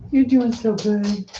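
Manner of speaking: slow, tender praise for the dog; pet praise. Real, not acted. Praising her dog Dixie.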